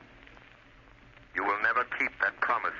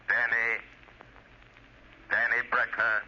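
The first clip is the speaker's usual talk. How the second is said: usual speaking voice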